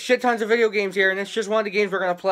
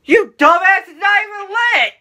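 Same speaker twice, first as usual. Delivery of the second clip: loud scolding; 10 semitones higher, 4 dB louder than usual. Real, not acted. Mock scolding sister.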